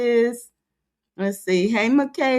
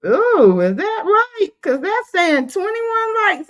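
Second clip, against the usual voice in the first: much higher, incredulous ooh; surprised disbelief; 9 semitones higher, 4 dB louder than usual. Real, not acted. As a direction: sound shocked at how few likes there are. Reacting to the low like count.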